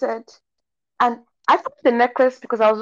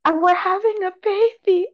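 mock sweet announcement; 11 semitones higher than usual. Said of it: "She said".

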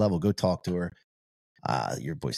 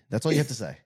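ordinary talk, nothing marked

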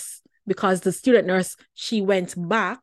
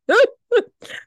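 a high burst of giggling; giggling at the memory; helpless laugh; 7 dB louder than usual. Real, not acted. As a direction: laugh as the memory hits you. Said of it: "it makes me chuckle".

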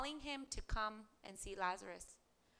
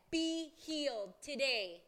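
commanding authority; 5 semitones higher, 5 dB louder, slower than usual. Acted. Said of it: "hear his authority".

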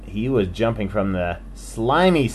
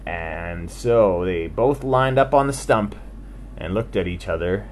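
plain reading voice

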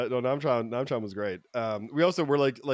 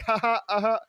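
high, put-on chuckle; imitated clueless laugh; 8 semitones higher than usual. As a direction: mimic someone laughing along without understanding. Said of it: "he'd just be like".

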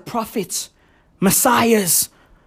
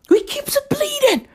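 squeaky high mock voice. Repeat in put-on voice.

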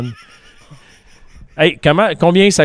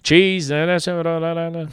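higher-pitched offer trailing into babble; imitated server patter; 6 semitones higher, 3 dB quieter than usual. Server runs through toppings for customer, imitated in higher babbling patter. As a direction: mimic a server's half-understood English, pitched up.

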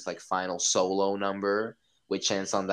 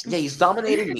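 high, louder laughing tease; joking through a laugh, higher; laughing joke; 9 semitones higher, 6 dB louder, faster than usual. Real, not acted. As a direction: laugh as you say it.

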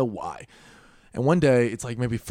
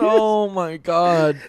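slow, drawn out, pitched up; stunned oh my god; 6 semitones higher, 8 dB louder, much slower than usual. Real, not acted. Reacting to a friend's gross story, slow and stunned. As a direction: let it drag out in disbelief. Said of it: "reacting to story".

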